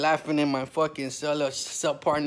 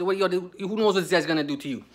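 ordinary talk

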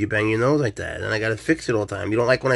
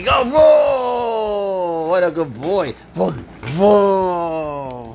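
excited pet talk; 8 semitones higher, 7 dB louder, much slower than usual. Real, not acted. Owner luring the cat to a toy snake, high excited baby talk. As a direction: get the cat hyped up.

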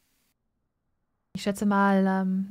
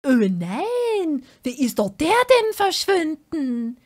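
shocked; 7 semitones higher, 6 dB louder, much slower than usual. Real, not acted. Object vanished from bar.

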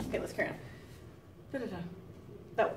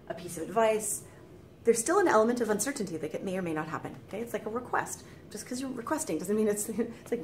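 ordinary talk, nothing marked

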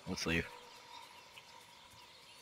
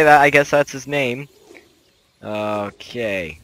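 usual speaking voice

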